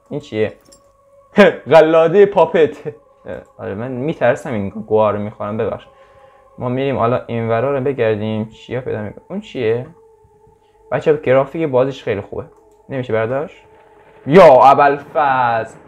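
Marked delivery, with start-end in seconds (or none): 1.4-2.9 laughing. Real, not acted. Laughing at a find in game. 14.3-15.8 startled cry. Real, not acted. Startled by a scare in game.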